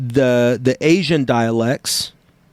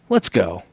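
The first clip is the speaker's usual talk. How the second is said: "let's go" said lower, with the stress on "let's"; a lower, settled "let's go"; 5 semitones lower than usual. Shown as a demonstration. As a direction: drop "let's go" down low and flat. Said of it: "in four different ways".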